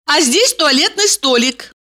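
put-on high girly voice; bubbly sorority girl. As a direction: chirp it brightly. Valeria voiced.

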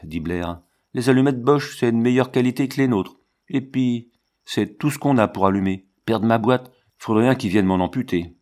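ordinary delivery, nothing marked

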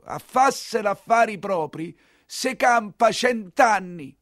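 high, slow mock voice reciting the proverb; quoting an old saying. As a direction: drag the proverb out like a sing-song warning. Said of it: "l'hai sempre detto".

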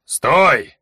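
sharp loud command; shout. A narrator's reading. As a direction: shout to freeze him. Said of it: "крикнул варвар".

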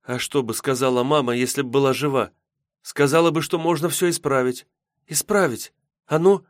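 put-on high-pitched girl thinking aloud; hopeful girl. Yulka wonders what mama would say, high hopeful voice. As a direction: build from wistful question to sudden resolve. Girl reasons toward hope.

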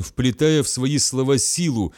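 plain reading voice

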